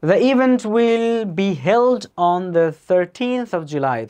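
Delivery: very slow, drawn-out words; slow dictation read. Shown as a demonstration. Teacher reading a listening-test sentence: very slow dictation.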